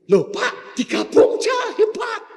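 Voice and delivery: high, loud excited pleading; eager shout. Acted. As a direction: push the idea with cheeky enthusiasm. Pupil pleads eagerly with teacher.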